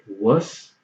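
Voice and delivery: wuss drawn out slowly. Shown as a demonstration. Teacher repeats wuss slowly for the viewer to copy. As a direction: model wuss slowly for the student. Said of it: "Say the word wuss".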